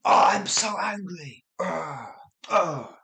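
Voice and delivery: high, loud emotional outburst; acted fuming complaint; openly angry. Shown as a demonstration. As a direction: demonstrate obvious anger with exasperated groans. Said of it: "One is obviously emotional".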